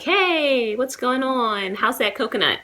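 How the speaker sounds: high, slow, breezy "Hey, what's going on?"; drawn-out friendly American hello; overly casual greeting. Shown as a demonstration. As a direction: saunter up to a stranger all chummy. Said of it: "I don't just go up and speak".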